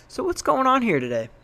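a hint of a homicide detective catching them out; detective mode. Confronting the suspicious visitors, lightly played detective. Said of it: "drops it into detective mode".

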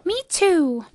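high little joey voice; high-pitched childlike echo; young kangaroo. A narrator's reading. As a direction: chime in from mother's pouch. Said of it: "kangaroo in her pouch said".

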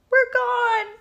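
very high, loud horse whine; whining. A narrator's reading. Horse sees he is gone from the farm, whining. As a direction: wail it high in dismay. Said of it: "whined Mister Brown".

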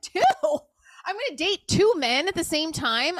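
high, slow incredulous questions; reacting in mock horror to two men; shocked. Replying to the medium, high and slow in disbelief.

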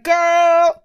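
high, loud mock-relatable call. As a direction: call it out as an exaggerated chummy exclamation.

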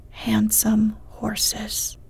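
plain reading voice

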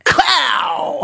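mock fight yell. Acting out Cass's fight blow.

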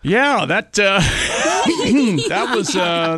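chuckling through the words; laughing. Real, not acted. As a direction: laugh while talking. Laughing after the silly bit.

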